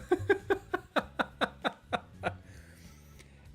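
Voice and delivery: breathless silent laugh; wheezing laugh. Real, not acted. Cracking up at own voice.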